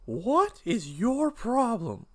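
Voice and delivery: slow, high angry question; exasperated confrontation. Acted. P J turning on Pete at dinner, exasperated confrontation. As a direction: draw out each word in exasperation. Son confronts his father.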